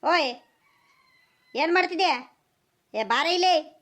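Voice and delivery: high, drawn-out calls; calling out loudly. Acted. Husband hollering for his wife to come, calling out loudly. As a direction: call out for someone out of sight. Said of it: "ಯಾಕೆ ಹಿಂಗ್ ಜೋರ್ ಜೋರಾಗಿ ಕೂಗ್ಕಂತಿದ್ಯಲ್ಲ".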